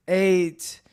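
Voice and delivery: normal talking voice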